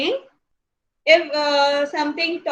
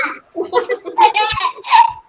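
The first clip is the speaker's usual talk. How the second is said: breathless high giggle; can't keep a straight face, giggle; real giggle; 14 semitones higher, 6 dB louder than usual. Real, not acted.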